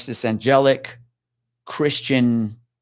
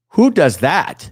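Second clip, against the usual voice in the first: loud, slow, high incredulous question; emphatic rhetorical outcry; appalled disbelief; 5 semitones higher, 6 dB louder, much slower than usual. Retold or imitated. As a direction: stretch it out in sheer disbelief. Appalled at the deadbolt, retold.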